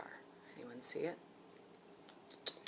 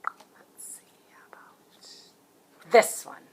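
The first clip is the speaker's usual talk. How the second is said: whisper; 2 semitones higher, much slower than usual. Acted. Teasing reveal of next letter.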